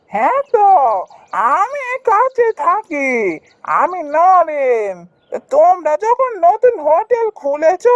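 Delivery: high, drawn-out busybody villager voice; high-pitched, theatrical customer; nosy local customer. Acted.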